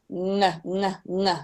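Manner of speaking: ng, ng, ng hummed through the nose; isolated ng drill; ng sound. Shown as a demonstration. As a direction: practise ng on its own. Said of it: "start of words like ngawai".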